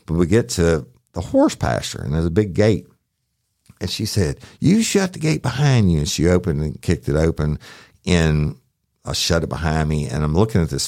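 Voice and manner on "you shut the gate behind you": high-pitched imitation of an angry, cursing woman; Miss Mary imitated. Deputy retelling the woman yelling back at him, high mock-angry voice. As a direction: squeak her up high, all attitude.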